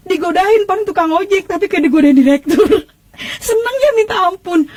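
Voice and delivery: high, giggly delight; overjoyed. Real, not acted. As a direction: gush with laughter at being flirted with. (girang sambil tertawa, nada tinggi)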